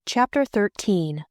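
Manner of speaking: usual speaking voice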